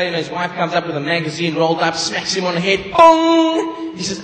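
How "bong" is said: a loud high cartoon bong; bong sound effect. Comedian voicing a magazine smacking the husband, loud high bong.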